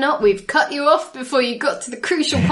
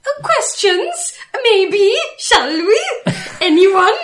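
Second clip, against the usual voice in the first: high, coaxing sing-song call; 11 semitones higher, 4 dB louder than usual. Acted. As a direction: lilt the words up high like a host. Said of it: "make me sound like I've lost my sweet mind".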